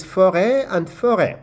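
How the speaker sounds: "forêt" said as fo-RAY, French style. Shown as a demonstration. Teacher showing learners two same-sounding words. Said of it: "Another example is".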